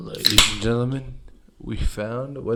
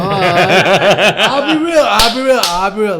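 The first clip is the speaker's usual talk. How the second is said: loud and much higher, breaking into laughter; laughing; 8 semitones higher, 15 dB louder than usual. Real, not acted. Host laughing loudly while promising honesty to the audience. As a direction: push through the giggles to insist.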